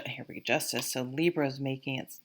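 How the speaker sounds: ordinary delivery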